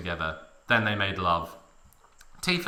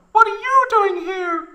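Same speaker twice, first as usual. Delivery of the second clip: shrill falsetto question, louder; high surprised Tifa; 8 dB louder than usual. A narrator's reading. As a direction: ask in a squeaky startled voice.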